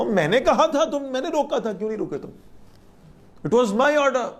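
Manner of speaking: a commanding voice that climbs high; stern rebuke, pitch up. Retold or imitated. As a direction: reproach them as one who gave the order. Preacher voices God's rebuke.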